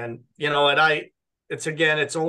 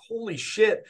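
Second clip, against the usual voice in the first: pitched-up mock shock; retold shocked exclaim; 7 semitones higher than usual. Alone in the car, the pain still there: a high mock "holy shit". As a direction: replay the moment of alarm, voice jumping up.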